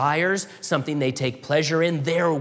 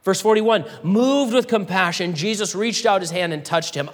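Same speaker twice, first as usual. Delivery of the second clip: normal talking voice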